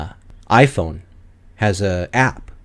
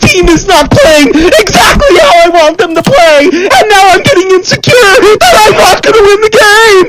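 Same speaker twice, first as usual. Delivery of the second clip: shrill high wailing fake sobs, shouted; whiny crying gamer; 12 dB louder than usual. Channel's [name] mocks toxic player, high loud fake crying. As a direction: bawl like a toddler mad at his team. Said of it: "this is what they're gonna hear".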